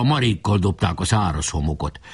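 ordinary delivery

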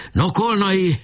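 high summoning call; shouting. A narrator's reading. Boka hails Kolnai in battle, calling high. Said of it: "kiáltott Boka".

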